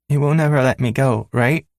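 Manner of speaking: put-on high child voice, asking for reassurance; a small girl clinging to her dad; pleading little girl. Daughter pleading with her father not to let go, high child voice.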